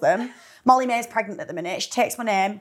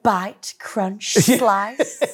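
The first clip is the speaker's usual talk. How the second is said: sensual advert voice; much slower than usual. Retold or imitated. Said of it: "it was very sensual".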